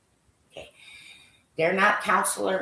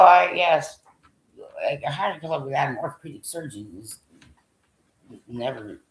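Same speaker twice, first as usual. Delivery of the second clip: ordinary talk, nothing marked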